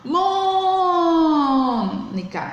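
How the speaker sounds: "Mônica" with MÔ held long and high; calling the stressed syllable. Shown as a demonstration. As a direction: call out "Mônica", stretching loudly on the first syllable. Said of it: "é aquela que eu chamo". (chamando "Mônica", esticando o MÔ)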